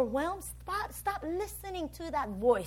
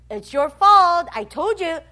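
loud, raised blaming; mock scolding, louder and higher; accusing voice; 5 semitones higher, 11 dB louder than usual. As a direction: throw the blame at them. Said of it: "that's not God".

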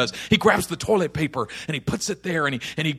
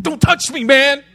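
loud, raised warning; angry; 5 semitones higher, 7 dB louder than usual. Acted. Josh warning his friend off his wound, angry. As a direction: warn him off angrily. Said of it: "And Josh is angry".

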